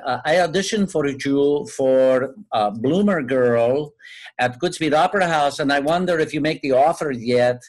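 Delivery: put-on disguised caller in a hammy Puerto Rican accent; horrible Puerto Rican accent. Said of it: "It was a horrible accent".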